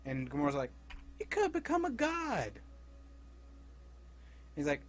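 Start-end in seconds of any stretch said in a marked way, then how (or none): 1.2-2.6 much higher put-on woman's voice; Gamora voice. Gamora urging him toward godhood, high coaxing voice.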